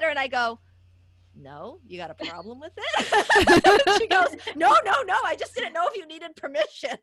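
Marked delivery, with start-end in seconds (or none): 1.4-3.2 slow sassy challenge; mock defiant comeback; defiant retort. 3.3-3.9 real loud laugh; loud high laugh. Real, not acted. Laughing after retort. 4.6-7.0 high, loud flustered no no no; mock nervous coworker; backpedaling coworker.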